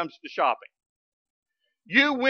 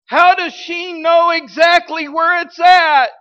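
much higher and louder, slow preacher shout; loud slow exclamation; 8 semitones higher, 8 dB louder, slower than usual. Acted. Preacher to the congregation, marvelling in a loud slow shout. Rhetorical punch.

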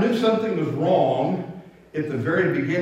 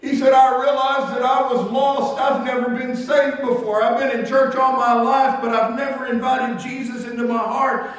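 much higher and louder, a mild imitation of a man crying out his confession; tearful confession; 7 semitones higher, 5 dB louder than usual. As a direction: voice the man breaking down over being lost. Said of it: "he was just crying like a baby".

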